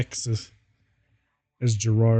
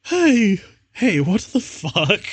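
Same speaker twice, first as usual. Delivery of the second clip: mock outraged yell; 8 semitones higher, slower than usual. Character outburst in fanfic reading.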